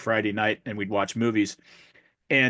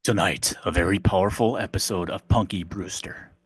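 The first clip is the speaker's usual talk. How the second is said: low, dramatic promo voice; lightly voiced TV trailer announcer; imitating a TV promo announcer; 5 semitones lower than usual. Mocking an old sitcom promo, low dramatic announcer voice.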